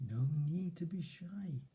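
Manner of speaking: whispered, gentle cheering on; soft encouraging whisper. Shown as a demonstration. Encouraging a shy six-year-old self in whispers. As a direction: cheer a shy child on in a hushed voice. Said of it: "soften your voice".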